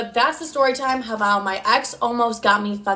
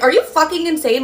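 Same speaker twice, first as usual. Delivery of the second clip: outraged disbelief; 6 semitones higher, 5 dB louder than usual. Retold or imitated. Acted quarrel, outraged at ex.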